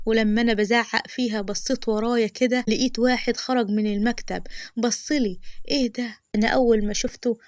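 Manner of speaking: ordinary delivery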